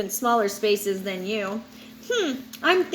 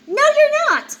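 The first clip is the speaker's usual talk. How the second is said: much higher and louder denial; loud objection; 14 semitones higher, 7 dB louder than usual. Acted. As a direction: cut in and shut it down. Old shark interrupts, contradicting.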